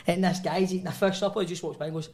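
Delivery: ordinary talk, nothing marked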